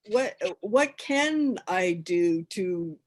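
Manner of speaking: ordinary talk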